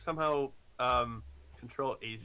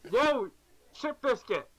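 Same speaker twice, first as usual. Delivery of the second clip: high, startled yelp; surprised exclamation; 11 semitones higher, 5 dB louder, slower than usual. Acted. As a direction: blurt it out in surprise. Hauling something across room.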